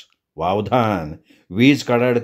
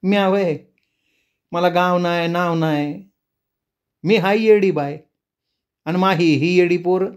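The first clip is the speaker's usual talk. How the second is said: high, odd voice of a madwoman; crazed woman; 5 semitones higher than usual. Acted. The mad woman tells him she has no village, high and strange. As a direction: sound unhinged, half laughing. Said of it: "खूळ लागल्यागत हसत राहिली".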